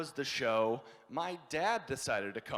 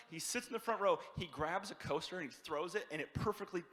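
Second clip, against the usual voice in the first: usual speaking voice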